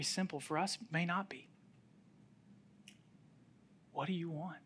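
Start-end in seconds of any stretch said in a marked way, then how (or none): 3.9-4.6 soft question. Retold or imitated. Jesus' question repeated softly.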